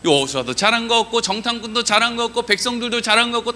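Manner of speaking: usual speaking voice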